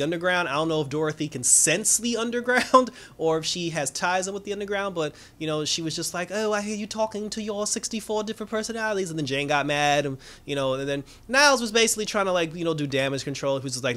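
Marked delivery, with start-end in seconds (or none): 6.3-9.1 higher-pitched, lightly voiced child; annoying kid. Kid prying into Jane's personalities, lightly childlike high voice. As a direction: imitate a tactless kid, pitched up. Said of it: "she's being a kid".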